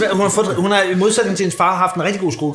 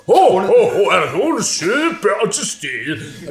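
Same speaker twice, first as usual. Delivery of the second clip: loud, slow jolly Santa boom; over-the-top children's-theatre Father Christmas; hammed-up Santa; 3 dB louder, much slower than usual. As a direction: play Santa like a kids' theatre show. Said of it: "der går helt, du ved, børneteater i den".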